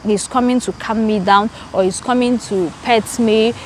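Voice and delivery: ordinary talk, nothing marked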